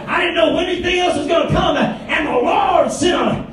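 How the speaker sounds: preaching testimony. Acted. Shouted testimony in sermon.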